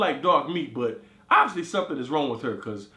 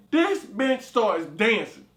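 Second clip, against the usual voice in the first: slow disbelief; 3 semitones higher, much slower than usual. Real, not acted.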